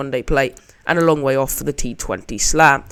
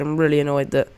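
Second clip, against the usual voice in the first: usual speaking voice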